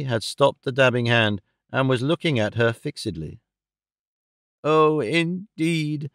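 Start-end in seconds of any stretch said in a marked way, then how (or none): 4.6-6.1 very drawn-out exclamation, pitch sweeping upward; crying out. A narrator's reading. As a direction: stretch each word into a mock-amazed cry. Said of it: "cried Fanny".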